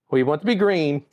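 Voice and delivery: usual speaking voice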